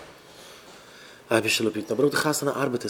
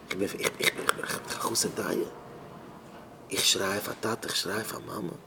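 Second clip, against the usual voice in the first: soft, low, halting voice; subdued, faltering murmur; hushed pleading; 5 semitones lower, 11 dB quieter than usual. Acted. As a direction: stammer it quietly, helplessly. Acted helpless cry to parents.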